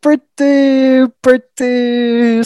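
drawn-out hesitation. Real, not acted.

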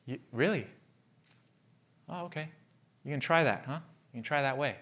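soft, sarcastic put-down; sarcastic dismissal. Retold or imitated. Wounded person doubting him, quietly scornful. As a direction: brush them off with quiet scorn. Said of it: "people I've wounded".

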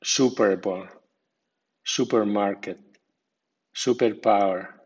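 SUPER leads in Super Bowl, supermarket, superpower; firm SU in Super Bowl, supermarket, superpower; strong stress on prefix. Shown as a demonstration. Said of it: "acento fuerte".

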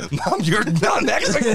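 high, laughing exasperation; laughing mock protest. Grown child arguing with mom, laughing in mock exasperation.

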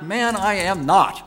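loud, high, slow shouted denial. A narrator's reading. Peter denying Jesus to the accuser, shouting back slowly. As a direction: fling the denial back at full voice. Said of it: "Peter shouted back".

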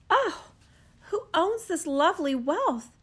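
high, wondering princess voice; a proud princess, suddenly curious; princess asking. Acted. Princess asks in forest.

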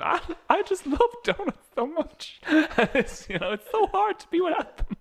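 high, whiny sob-story voice; a lightly hammed-up whining dieter; mock crying American. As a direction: whimper like a sulky dieter.

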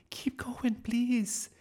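high, urgent stage whisper. A narrator's reading. Miss Darling begging Nick to continue, stage-whispered. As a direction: plead in a squeaky stage whisper. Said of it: "whispered Miss Darling".

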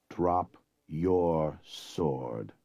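low, very slow, menacing order; cold command. Acted. Wesley ordering the prince to yield, low and very slow. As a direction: drag it out low and deadly.

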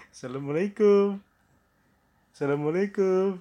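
high, loud greeting at the door; loud calling out. Acted. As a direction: holler to be heard inside. Calls louder at the door.

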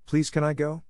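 high-pitched, eager plea; pleading. A narrator's reading. Girl asking to go to the picnic, pleading. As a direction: gently beg for permission. Anne begs to attend picnic.

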